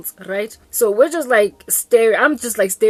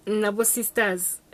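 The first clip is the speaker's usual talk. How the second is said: ordinary delivery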